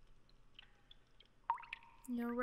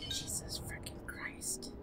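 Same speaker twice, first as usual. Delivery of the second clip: breathless whisper; 10 semitones higher, 6 dB quieter, slower than usual. Real, not acted. Startled at game event.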